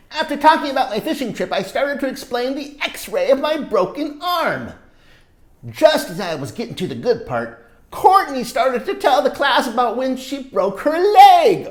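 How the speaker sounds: put-on lively read-aloud for kids; storybook narration. A narrator's reading. Reading a story to children, animated narration. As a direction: tell it like a picture book to children. Reads picture book aloud.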